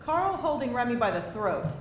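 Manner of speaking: ordinary talk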